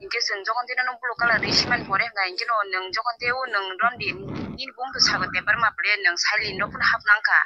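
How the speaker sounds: normal talking voice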